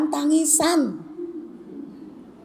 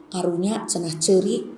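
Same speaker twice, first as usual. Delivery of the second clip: grandmother in Sundanese; 5 semitones lower than usual. Retold or imitated.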